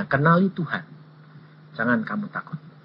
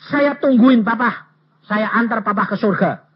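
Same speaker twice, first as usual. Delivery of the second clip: shouting to deaf father; 5 semitones higher, 5 dB louder than usual. Retold or imitated. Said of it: "Saya teriak-teriak loh".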